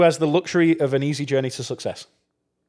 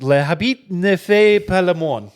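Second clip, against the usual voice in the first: French pronunciation; 4 semitones higher, slower than usual. Shown as a demonstration. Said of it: "Le habit ne, oh, jeez".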